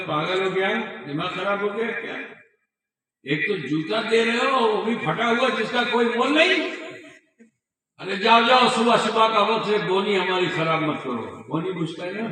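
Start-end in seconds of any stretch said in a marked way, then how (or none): 0.1-11.2 scornful scolding. Retold or imitated. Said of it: "तो दुकानदार ने कहा".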